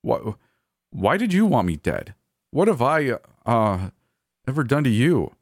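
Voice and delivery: wounded, faltering questions; tearful. A narrator's reading. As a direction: plead on the verge of tears. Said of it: "tears rapidly forming in my eyes".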